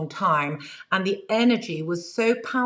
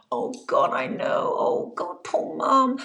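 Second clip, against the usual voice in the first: mock lament; slower than usual. Imitating a grieving spirit she wasn't.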